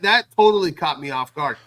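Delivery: usual speaking voice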